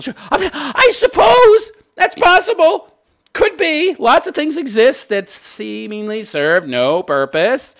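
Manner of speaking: high, slow mock agreement; sarcastic. Real, not acted. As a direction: concede with heavy irony. Mock concession to critic.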